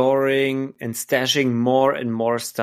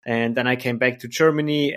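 usual speaking voice

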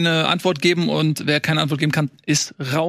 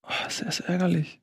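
annoyed whisper; 8 dB quieter than usual. Real, not acted. Missed the question.

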